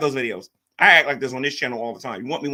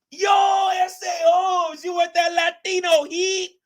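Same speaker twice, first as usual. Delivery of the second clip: high, slow shouted wrestler catchphrase; Eddie Guerrero impression; 4 dB louder, slower than usual. Streamer quotes a wrestler to chat, shouted high. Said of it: "I'm quoting Eddie Guerrero".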